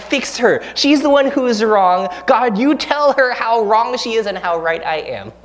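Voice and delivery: indignant demand. Retold or imitated. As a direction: insist you are right, half-hammed. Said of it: "my prayers inevitably start out".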